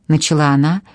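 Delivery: ordinary delivery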